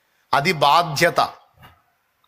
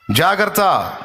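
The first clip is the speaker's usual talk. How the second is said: normal talking voice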